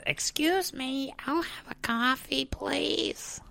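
very high, slow, soft cartoon mom; polite order in a strained falsetto, stretched out; Marge. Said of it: "she's like".

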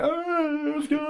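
high, sloppy party yell, mock-drunk; hammed-up wasted festival cheer; drunk self. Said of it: "just fucking blind drunk".

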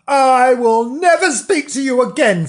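high, loud, indignant declaration; huffy outburst. Acted. Bernard breaking off friendship with Frank, huffy outburst. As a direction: storm off in storybook outrage.

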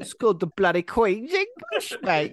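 very high, squeaky parody of English outrage; mock indignant Brit. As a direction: defend the mother tongue in absurd falsetto. Mock indignant defence of British speech.

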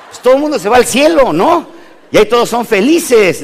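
mocking the promise of heaven, high and loud; mock enthusiasm. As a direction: sell heaven like a giddy preacher. Sarcastic mock preacher exclamation.

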